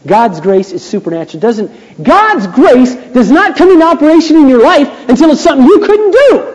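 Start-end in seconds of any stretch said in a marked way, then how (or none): 2.0-6.5 loud, high preacher's build; rising pulpit shout; preaching at full force. Acted. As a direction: drive it home to the congregation.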